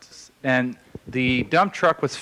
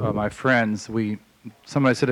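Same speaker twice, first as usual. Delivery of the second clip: ordinary talk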